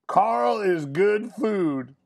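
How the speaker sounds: slow, high, grand mock proclamation; Carl. Bully announcing his chosen tattoo, drawn out and proclaiming. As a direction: stretch it out as the big final slogan. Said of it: "I have to quote Carl".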